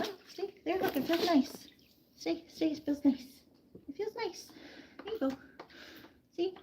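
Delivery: sweet-talking the pet through its bath; coaxing pet talk. Real, not acted. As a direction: coax the nervous pet gently into the soak.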